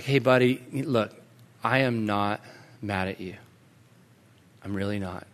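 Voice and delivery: low, very soft, with pauses; retold dad soothing his upset son; gentle reassurance. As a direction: reassure softly, letting each phrase settle. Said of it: "I just began to softly explain".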